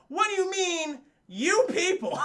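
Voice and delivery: high, loud mock outrage; hammed-up indignant challenge; Brandon T. Jackson outraged. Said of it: "When Brandon T. Jackson goes".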